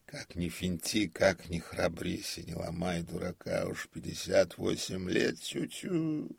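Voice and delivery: grumbling. A narrator's reading. Old comic alone in the dark theatre, grumbling. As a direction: grumble and tut at your own old age. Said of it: "продолжал ворчать".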